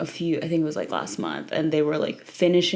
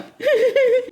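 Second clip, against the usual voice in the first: high giggle; 4 dB louder than usual. Real, not acted. Laugh before chanting.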